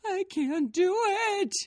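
high, loud furious wail; angry scream. A narrator's reading. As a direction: scream it in a tantrum. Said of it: "Gabe screamed angrily".

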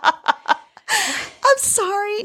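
high, very slow laughing sorry. Real, not acted. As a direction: giggle through the apology. Laughing fake apology.

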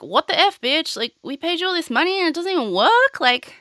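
high-pitched, indignant imitation; hammed-up, incredulous complaint rising very high; mock outrage. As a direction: squeal it like a cheated customer. Acted outrage of cheated Walgreens.

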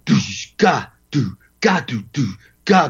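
beatbox the groove with your mouth; vocalized drum beat. Retold or imitated. Drummer showing the host his beat, vocal drum sounds. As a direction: drum pattern sung as do and ga syllables.

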